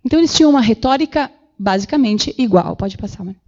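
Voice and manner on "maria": ordinary delivery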